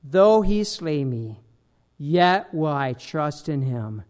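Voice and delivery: slow and low, every word weighed; solemn slow reading. Acted. Reading key faith verse.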